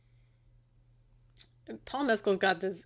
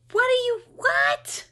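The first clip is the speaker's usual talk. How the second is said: disbelieving squeak rising to a near cry; incredulous; 4 dB louder, slower than usual. Real, not acted. Ranting to co-host about the movie's ending, incredulous pitch climb. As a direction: voice climbing much higher in disbelief.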